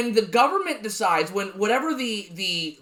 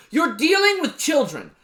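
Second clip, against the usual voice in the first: raised, exasperated; 6 semitones higher than usual. Real, not acted. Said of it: "That's what I realized".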